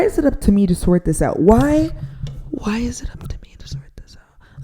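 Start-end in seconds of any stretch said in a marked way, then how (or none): 2.5-4.6 whispered to keep from shouting; fed-up whisper holding back a shout; restrained whisper. Real, not acted. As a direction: whisper it so you don't yell. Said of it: "I'm trying to whisper so I don't shout".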